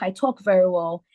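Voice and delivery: ordinary talk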